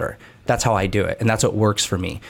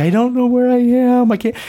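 high-pitched, louder whiny wail of a lost runner; mock crying panic; 13 semitones higher, 3 dB louder than usual. Runner on the phone to his wife, lost in the canyon, mock wailing. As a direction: replay your own teary breakdown, hammed up. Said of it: "I was crying".

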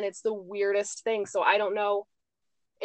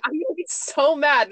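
higher, louder playful mock fury; mock anger; 7 semitones higher, 6 dB louder than usual. Retelling her dread of becoming a nun, in mock anger. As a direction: ham up the outrage, half joking. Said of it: "I am going to be so angry".

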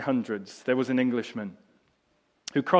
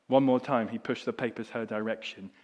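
ordinary delivery

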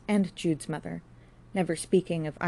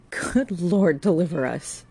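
louder hammed-up fright; mock terror; 6 dB louder, slower than usual. A narrator's reading. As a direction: pretend to be scared of her. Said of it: "said in mock terror".

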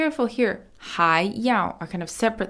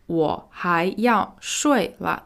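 ordinary delivery

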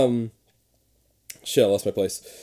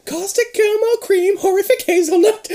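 much higher sing-song list; 3 dB louder than usual. Pretend. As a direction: lilt it up playfully. High sing-song reading.

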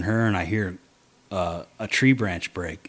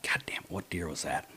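hushed, unvoiced whisper, much softer; whispered curse; 4 semitones lower, 12 dB quieter than usual. Hunter in a tree above a doe, whispering a frustrated curse. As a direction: curse under your breath so the doe won't hear.

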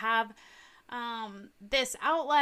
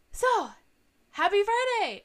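excited greeting; 10 semitones higher, 7 dB louder than usual. Real, not acted. Bright podcast greeting.

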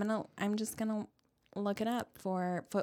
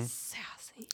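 breathy, hushed, drawn-out sass; sassy; 14 dB quieter than usual. Shown as a demonstration. Host describing own hungry mood, sassy whisper. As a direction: whisper it with attitude. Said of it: "I get real defensive and real".

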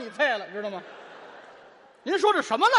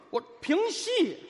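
评戏 said slow and higher; 评戏 with 评 raised and stretched; stress on 评; 7 semitones higher, 3 dB quieter, slower than usual. Shown as a demonstration. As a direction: lean hard on 评 in 评戏. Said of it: "这什么叫评".

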